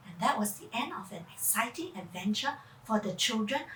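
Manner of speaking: ordinary delivery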